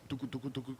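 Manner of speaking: very soft playful tucu tucu sounds; soft sucking noise. Retold or imitated. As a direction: mimic sucking pain away quietly. Imitating sucking out the pain. (imitación suave de succionar)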